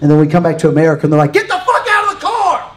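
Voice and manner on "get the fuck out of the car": high, strained scream of an order; imitated screaming command, pitch shot way up; angry border yell. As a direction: yell it like a furious officer. Imitated American border officer yelling.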